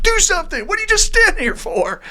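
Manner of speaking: shouting high and loud at a stranger, demanding; a panicked man yelling at the phone guy to fix it now; angry yell. Retold or imitated. Stranger yells at phone worker.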